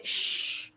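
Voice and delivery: long soft hushing noise; imitated "shh" of a critic; shushing. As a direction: mimic being shushed. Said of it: "they say".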